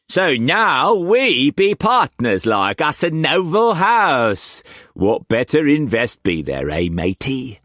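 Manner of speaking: high, loud Cockney pirate-trader voice; Cockney swagger, pitched well up and pushed; harsh Cockney accent. A narrator's reading. Wu Kwok pressing food on Struan, in harsh Cockney accent. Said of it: "the harsh Cockney accent".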